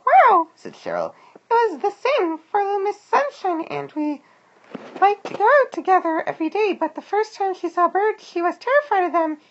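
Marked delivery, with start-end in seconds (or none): 0.1-0.5 loud, very high squeaky wow; Cheryl amazed. A narrator's reading. Cheryl reacts to Walter's tale. 1.3-9.4 very high, squeaky worm voice; Cheryl recounting. A narrator's reading. Cheryl answers Walter at length.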